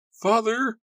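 put-on very high, loud girl's plea; pleading. A narrator's reading. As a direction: plead desperately with father. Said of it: "Susanna pleaded".

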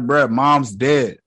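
drawn out slowly, deadpan; slow realization. Retold or imitated. Retelling Steven's realization about his mom, slow and deadpan. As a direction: let it land slowly.

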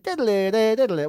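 slow sing-song phone noise; parrot's copy of a ringtone; imitated ringtone. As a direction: mimic a phone ringing in nonsense syllables. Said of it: "He'd memorised the ringtones".

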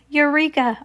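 high triumphant exclamation; triumphant cry. A narrator's reading. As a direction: cry out at a sudden discovery.

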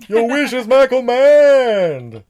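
slow, booming genie voice; Wishmaster voice. Acted. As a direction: boom it out like an evil genie. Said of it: "It was the Wishmaster".

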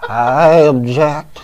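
put-on slow, creaky elderly justice objecting; Ruth Bader Ginsburg impression. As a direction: object slowly like an aged judge. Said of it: "That's his Ruth Bader Ginsburg impression".

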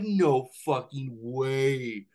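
slow, drawn-out retold disbelief; replayed amazement, words dragged out; stunned disbelief. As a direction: stretch every word in acted amazement. Retells disbelief at news article.

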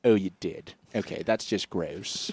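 lightly voiced grossed-out Doctor Evil; disgusted character voice. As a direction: recoil from the gross moment. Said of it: "he goes".

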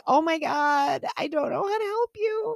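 helpless. Retold or imitated. Mother's helpless cry to son.

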